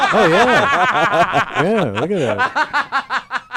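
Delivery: high laughter through the words; laughing. Real, not acted. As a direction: laugh while speaking, slowly. Laughing at matching haircuts.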